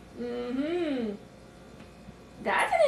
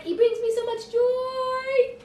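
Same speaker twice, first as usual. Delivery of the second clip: gushing; 6 semitones higher, slower than usual. Retold or imitated. Gushing quote of own feeling.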